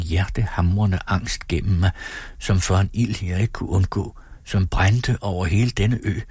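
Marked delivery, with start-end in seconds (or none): none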